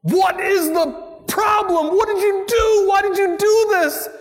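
high, loud, exasperated scolding; the relieved mother turning on her runaway son; frantic mother scolding. Retold or imitated. Mary scolding the found child.

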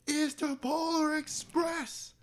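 high, slow mocking voice. Mocking movie title.

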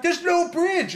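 very high, loud mock shriek; hammed-up alarm about the broken bridge; panicked shout. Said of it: "She's like".

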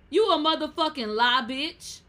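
loud, high outraged yell; outraged shout. Acted. Host ranting at parents who walk out, loud outrage. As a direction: call them out, furious.

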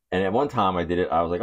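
ordinary talk

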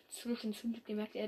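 ordinary delivery, nothing marked

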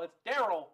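"Daryl" loud, stressed on the first syllable; corrective pronunciation. Shown as a demonstration. Co-host correcting the reader's name, "Daryl" stressed up front. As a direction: correct it firmly to DAR-ul, "Daryl". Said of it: "You over here, you over here saying Darrell".

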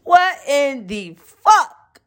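high, loud, stretched-out shock; shocked disbelief. Retold or imitated. Arrested girlfriend reacting, hammed-up shock. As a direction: hit What high, then drag it slowly. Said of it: "And she like".

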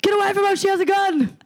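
high, shrieking mock panic; hammed-up panic at a gun; panicked yell. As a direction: scream a warning in mock terror. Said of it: "They're like".

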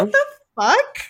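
high incredulous squeak. Real, not acted. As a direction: shriek it in disbelief. Reacting in disbelief.

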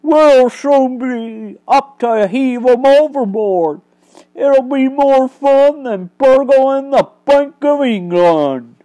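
gloating bank robber. Acted. Barney boasting to the prisoners, loud and gloating. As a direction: relish the cruel fun ahead.

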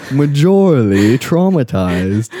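slow, mock European accent. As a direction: drag "Majorly traumatized" out in a put-on European accent. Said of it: "from Europe on this episode".

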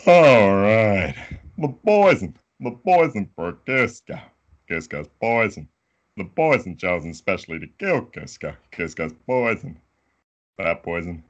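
big booming cartoon henchman, loud burst settling down; animated sidekick puzzling aloud; Kronk. Shown as a demonstration. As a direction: open high and loud, then ease into a dim henchman's musing. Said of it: "I'll do Kronk from Emperor's New Groove".

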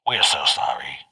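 hushed whisper. Retold or imitated. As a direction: breathe the line softly. Whispered repeat of the lyric.

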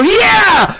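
high cheering shout from the back; heckler shout. Acted. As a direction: yell it out of the crowd.

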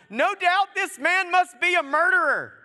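loud, high, hammed-up verdict; shouted accusation. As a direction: cry it out like an alarmed crowd condemning someone. Acts islanders accusing Paul.

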